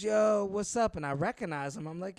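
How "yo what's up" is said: a higher, louder street hello; calling out a greeting. Retold or imitated. Old ticket seller hails the comedian on the street, loud and high. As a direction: hail an old acquaintance across the sidewalk.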